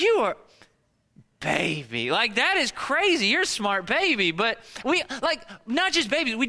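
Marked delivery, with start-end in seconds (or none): 0.0-4.3 amazed praise. Retold or imitated. Father praising his baby for fooling him, amazed. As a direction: marvel at the clever baby. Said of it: "I was like".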